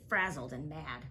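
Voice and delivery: plain reading voice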